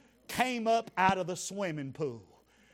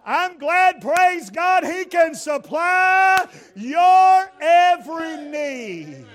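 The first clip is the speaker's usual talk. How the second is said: much higher and louder, slow on every; shouted preaching climax; 8 semitones higher, 12 dB louder, slower than usual. Acted. Preacher praising God's supply to the crowd, full shouted preaching. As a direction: ring it out to the back pews.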